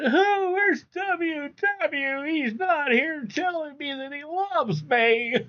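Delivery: high, slow, whiny mock sobbing with a laugh; mock a crybaby pining for someone, hamming it up; mock crybaby whine. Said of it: "I don't go running and crying saying".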